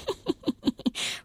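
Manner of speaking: hohes, leises Kichern des Strauchs; schadenfrohes Lachen. A narrator's reading. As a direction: kichere gemein über die Kinder. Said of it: "lachte der Brombeerstrauch". (hohes schadenfrohes Kichern)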